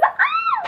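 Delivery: high excited squeal. Acted. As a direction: squeal with delight. Host squeals at guest.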